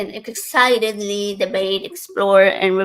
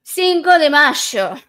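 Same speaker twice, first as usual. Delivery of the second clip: slow, loud Argentine Spanish accent; 7 semitones higher, 8 dB louder, slower than usual. Shown as a demonstration. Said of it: "I'm gonna say it in an Argentinian accent".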